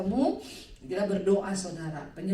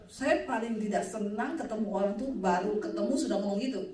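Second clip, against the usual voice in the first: usual speaking voice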